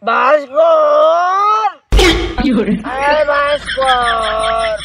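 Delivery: long drawn-out shout across a field; calling out. Acted. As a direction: stretch the name, call him from afar. Calling lost friend outdoors. (দূর থেকে টেনে ডাকা)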